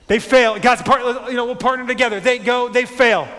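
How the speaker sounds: full-force preacher refrain; preaching at full force. Acted. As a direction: thunder it to the congregation. Preaching burst.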